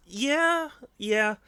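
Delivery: high excited yelp; excited squeal. Real, not acted. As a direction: burst out excited. Excited reaction.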